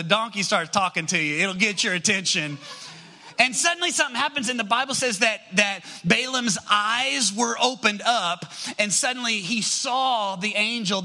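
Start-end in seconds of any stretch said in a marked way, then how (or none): 3.4-11.0 higher, fast then slowing on the reveal; preacher reaching the story's turn; preaching at full force. Acted. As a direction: build excitement, then land it. Preaching climax of Balaam story.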